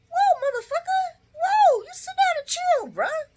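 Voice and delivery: very high-pitched mock rebuke; mock indignant boss. Vlogger acting out the boss calming his friend, high-pitched mock indignation. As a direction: squeal the order to back off. Boss retort in acted quarrel.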